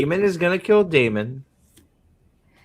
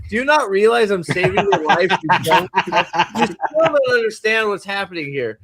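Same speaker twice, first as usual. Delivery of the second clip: louder, higher, laughing disbelief; mock exasperation; 5 semitones higher, 4 dB louder than usual. Retelling someone saving an idiot's life, mock exasperation. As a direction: plead in hammed-up exasperation, laughter creeping in. Acting out an exasperated would-be rescuer.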